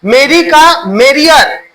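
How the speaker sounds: ordinary talk, nothing marked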